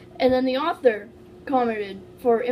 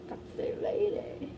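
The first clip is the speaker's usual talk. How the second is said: high, slow hushed whisper; 9 semitones higher, 7 dB quieter, slower than usual. Real, not acted. Aside to friends as reading starts: hushed whisper. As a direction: breathe it out under your breath.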